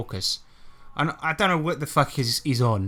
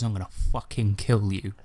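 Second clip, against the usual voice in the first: mock menacing threat; 5 semitones lower than usual. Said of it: "leans in and goes".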